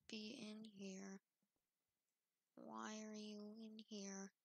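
hushed whisper to the dark room; breathy nervous whisper. Acted. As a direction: whisper it so nothing hears. Talking to animatronic, hushed.

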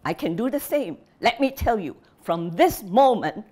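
a father disowning his daughter in fury; shouting in rage. Acted. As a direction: yell it through the house. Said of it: "shouting into the hot afternoon air".

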